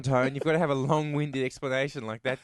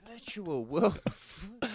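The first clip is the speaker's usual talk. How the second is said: mock awe; 4 semitones higher, 5 dB quieter, much slower than usual. Real, not acted. Mocking quote, laughing.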